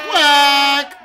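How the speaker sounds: long, loud, high quack; belted duck call. Pretend. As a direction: let out a big drawn-out duck quack. Duck race host quacks loudly.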